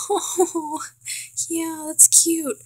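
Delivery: high, drawn-out gushing "aww" voice; mock swooning coo. Viewer reacting to childhood love scene, high mock coo.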